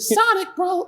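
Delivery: put-on very high-pitched little Puerto Rican boy; tiny, high cartoon boy voice; Tails. Shown as a demonstration. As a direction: squeak it like a little kid sidekick. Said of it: "like, a high-pitched".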